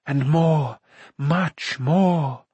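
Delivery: slow, drawn-out, lilting coaxing; seductive murmur. A narrator's reading. Akron tempting Taran with a gift, seductive. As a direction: tempt with slow, swooping words. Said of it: "Akron whispered".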